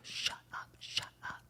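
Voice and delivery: hushed unvoiced shut up; urgent whisper. [name] hiding from his ex at the door, whispering. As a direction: hiss it so nobody outside hears.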